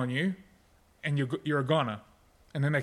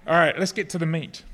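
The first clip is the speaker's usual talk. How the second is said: ordinary talk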